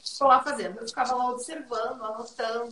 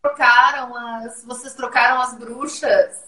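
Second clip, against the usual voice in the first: mock surprise; 8 dB louder, slower than usual. Real, not acted. Host teasing about swapped guests.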